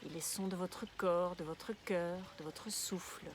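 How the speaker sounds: guided meditation. Real, not acted. Guide une relaxation de yoga nidra. (voix douce et posée de méditation guidée)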